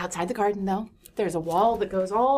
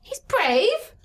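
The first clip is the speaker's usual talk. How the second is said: indignant; 12 semitones higher than usual. Acted. Child defends brother.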